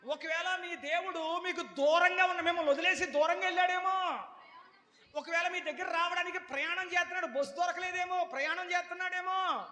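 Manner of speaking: high loud mocking taunts; sarcastic shouting. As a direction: shout silly excuses for their god. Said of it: "ఎటకారంగా మాట్లాడతా". (ఏలియా గట్టిగా ఎగతాళి చేస్తూ)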